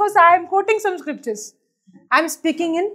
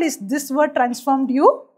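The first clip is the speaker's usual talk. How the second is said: ordinary talk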